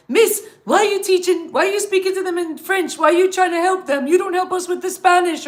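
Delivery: put-on student whining to teacher about favoritism, high kid voice; complaining Caribbean kid. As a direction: mimic a schoolkid protesting to the teacher. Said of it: "kids from the Caribbean would be like".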